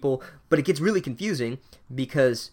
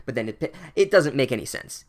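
ordinary delivery

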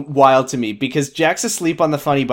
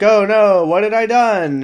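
mock horror; 7 semitones higher, 3 dB louder than usual. Acting Jack's horrified regret.

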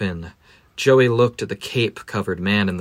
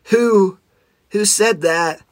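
startled; 8 semitones higher, slower than usual. Acted. Joey startled by a voice.

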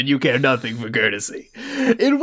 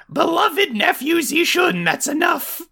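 high, slow haughty aunt; Madame Jin voice; 13 semitones higher, slower than usual. Acted. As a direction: lift the voice high and prim. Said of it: "regular and different voice".

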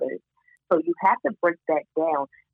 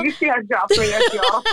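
high, loud, laughing through it; amused protest to the host; 12 semitones higher, 5 dB louder than usual. Real, not acted. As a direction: laugh while calling them out. Laughing protest at being teased.

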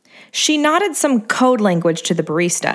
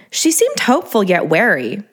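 plain reading voice

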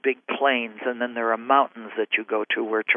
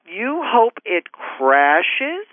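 very slow, disbelieving question; stunned slow-motion rising question; incredulous echo; 7 semitones higher, much slower than usual. Retold or imitated. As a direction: repeat it back in stunned disbelief. Her incredulous inner reply.

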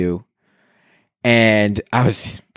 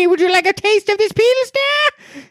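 much higher, cackly witch hostess voice; witch server. Acted. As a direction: play a sugary witch offering a pour. Witch-costumed brewery staff offering beer.

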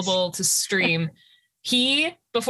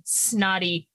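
ordinary talk

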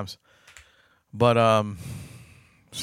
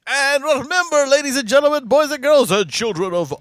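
high, loud, stretched announcer call; grand entrance announcement; 11 semitones higher, 6 dB louder, slower than usual. Acted. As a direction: announce it like a ringmaster. Show sign-off announcer call.

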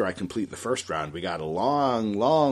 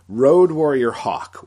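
slow, much louder growled ring-name bellow; Road Warrior Hawk impression; 4 semitones higher, 10 dB louder, slower than usual. Host trying Road Warrior Hawk's voice, a bad, slow, loud impression. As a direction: bellow the name like a face-painted brawler. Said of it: "that was a terrible Road Warrior Hawk impression".

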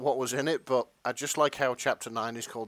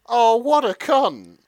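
loud, higher, slow outrage; loud outraged reaction; 9 semitones higher, 10 dB louder, slower than usual. Real, not acted. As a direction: blurt out disgust. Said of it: "reacting to the story".

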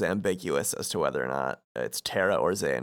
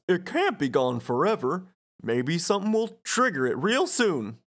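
higher, swooping goofball voice; Goofy; 7 semitones higher than usual. Acted. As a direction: do the lovable cartoon goofball, pitched up. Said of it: "Goofy says".

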